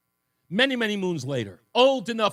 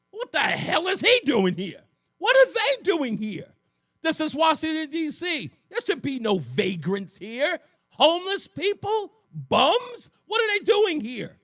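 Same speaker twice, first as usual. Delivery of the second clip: put-on higher and louder, a kid's stunned disbelief; shocked outburst; 8 semitones higher, 4 dB louder than usual. Twelve-year-old on the bus asking his teacher, shocked disbelief. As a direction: blurt it out like you can't believe your eyes.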